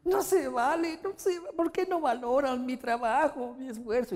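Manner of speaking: mock crying protest; imitating tearful whining. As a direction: wail the complaint like a hurt mother. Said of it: "Y chillando".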